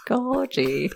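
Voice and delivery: high mock-Cockney imitation; terrible Cockney accent. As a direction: try out a bad Cockney accent, rising at the end. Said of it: "terrible Cockney".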